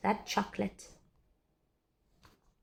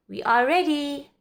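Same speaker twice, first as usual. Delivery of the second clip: loud, higher call; 6 semitones higher, 6 dB louder than usual. A narrator's reading. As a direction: announce it proudly across the yard. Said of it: "called Amelia Bedelia".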